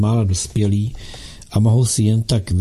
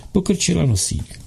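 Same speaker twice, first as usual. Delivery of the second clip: ordinary delivery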